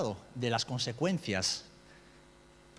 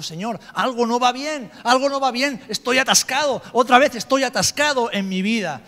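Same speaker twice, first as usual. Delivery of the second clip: loud, anguished plea to God, higher; desperate cry; 10 semitones higher, 11 dB louder than usual. Retold or imitated. Man praying alone, crying out loudly to God in anguish. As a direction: cry out to the Lord as if stuck and at the end of your rope.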